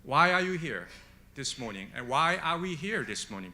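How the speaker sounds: ordinary talk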